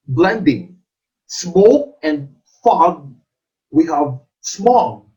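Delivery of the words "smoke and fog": ordinary talk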